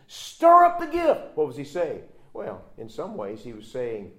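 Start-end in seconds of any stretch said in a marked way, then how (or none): 0.1-1.4 much higher, louder, slow on stir up; urgent exhortation. Acted. Paul exhorting Timothy, high loud slow stir up. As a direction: rouse him with the word.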